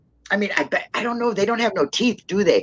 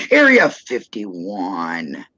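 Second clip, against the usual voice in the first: slow, drawn-out words with a laugh; laughing repeat; 10 semitones lower, much slower than usual. Real, not acted. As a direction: stretch it out, chuckling.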